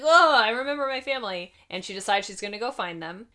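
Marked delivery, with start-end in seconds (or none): 0.0-1.5 excited realization. Retold or imitated. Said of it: "she's like".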